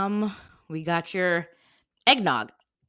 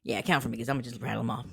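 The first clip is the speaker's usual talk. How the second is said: fast; 7 semitones lower, 7 dB quieter, much faster than usual. Real, not acted. Rushing before listing names.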